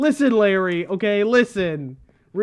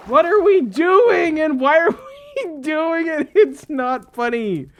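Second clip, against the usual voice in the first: laughing frustration; 6 semitones higher, 5 dB louder than usual. Real, not acted.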